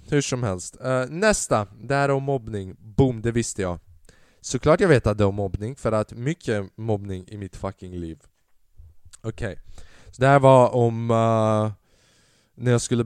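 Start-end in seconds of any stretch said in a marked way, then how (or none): none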